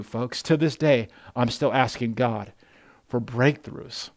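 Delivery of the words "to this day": ordinary talk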